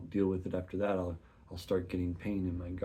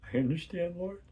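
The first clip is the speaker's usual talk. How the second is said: tearful answer; 11 semitones higher than usual. Acted. Answering God in prayer.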